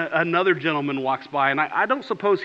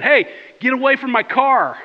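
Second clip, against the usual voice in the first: yelled, higher and louder; angry shout; 7 semitones higher, 6 dB louder than usual. Retold or imitated. Car owner yelling across the parking lot, angry shout. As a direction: shout him off your car. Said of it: "and they said".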